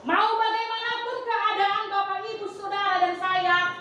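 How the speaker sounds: seruan khotbah lebih tinggi, pelan dan berirama; khotbah setengah dinyanyikan. Acted. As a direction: lantunkan seperti seruan yang mengajak jemaat bertahan. Preacher chants exhortation, half sung. (pengkhotbah menguatkan jemaat dengan seruan setengah bernyanyi)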